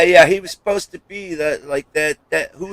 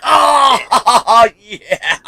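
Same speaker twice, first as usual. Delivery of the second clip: long, higher-pitched whoop breaking into a laugh; excited cheer; 5 semitones higher than usual. Retold or imitated. Telling friends his reaction to the accent, excited cheer. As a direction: shout it like a thrilled fan.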